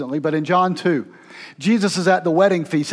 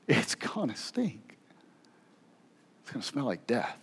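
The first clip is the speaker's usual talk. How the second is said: whisper; 7 semitones lower, 12 dB quieter than usual. Acted. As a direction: breathe it as a grim warning. Martha warning about the tomb.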